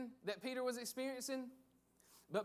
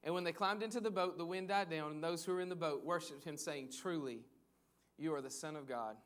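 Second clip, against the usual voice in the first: measured, lower Bible reading; scripture reading; 4 semitones lower than usual. Acted. Pastor reads the Gospel passage to the church, measured and reverent. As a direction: read the passage aloud to the congregation.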